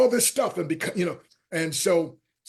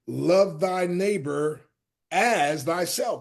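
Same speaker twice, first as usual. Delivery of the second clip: slow scripture quote; much slower than usual. Retold or imitated. Quoting scripture slowly.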